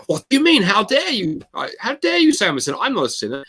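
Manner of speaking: high-pitched mock indignation; irate. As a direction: act out someone deeply affronted by an accusation. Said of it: "would get really irate and go".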